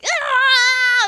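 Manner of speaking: high, loud mock scream from the floor; imitated scream. Spanish teacher on the floor, loud mock scream. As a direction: shriek it like a teacher acting out birth. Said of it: "starts screaming".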